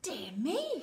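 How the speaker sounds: a touch of gasping dismay; gasped. Acted. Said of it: "she gasped".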